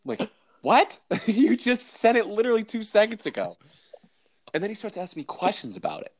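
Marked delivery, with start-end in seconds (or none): 0.6-3.6 high-pitched incredulous retort; exasperated disbelief, pitched way up. Retold or imitated. Employee retelling his reply to an instant follow-up call, incredulous. Boss calls right after emailing.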